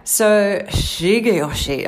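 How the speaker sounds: hammed-up Japanese pronunciation of Shigeyoshi; Japanese accent. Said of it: "I won't do accents all the way through".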